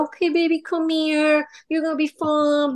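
high-pitched mock baby-soothing; coddling mom. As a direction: imitate a mother fussing over her little boy. Voicing an over-coddling boy mom.

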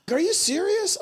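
high-pitched disbelief; incredulous. Retold or imitated. Customer to delivery crew about a broken screen, incredulous. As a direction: react in a high voice, as if hearing bad news. Retold reaction to broken bike.

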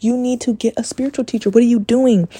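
high-pitched retold scolding; exasperated. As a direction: urge a client, at wits' end. Exasperated retold reply to client.

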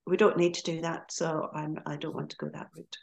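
normal talking voice

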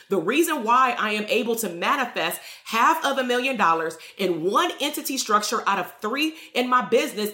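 ordinary talk, nothing marked

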